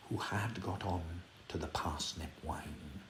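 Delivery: usual speaking voice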